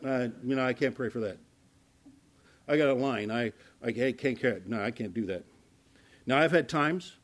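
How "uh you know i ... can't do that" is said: reluctant minister. Retold or imitated. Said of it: "and you say".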